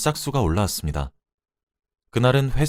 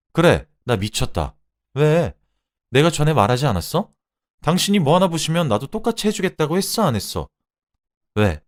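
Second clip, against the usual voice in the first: raised, higher-pitched mocking challenge; sarcastic taunting; 6 semitones higher, 4 dB louder than usual. A narrator's reading. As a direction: needle him with scornful questions. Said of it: "비꼬았죠".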